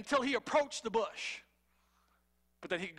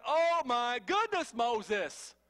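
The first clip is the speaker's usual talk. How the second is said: higher, louder, slowed disbelief; exasperated God; 9 semitones higher, 4 dB louder than usual. Retold or imitated. God exasperated with Moses's endless excuses. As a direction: throw up your hands at Moses. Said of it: "And God's like".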